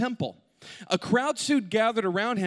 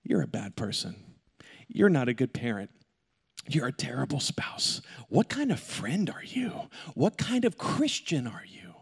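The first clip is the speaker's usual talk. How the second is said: a low, hushed accusing voice, sometimes whispered; taunting accuser whispering condemnation; accuser's voice; 6 semitones lower than usual. Acted. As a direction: play the accuser, lightly, low and hissing. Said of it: "the accuser, to show up and say".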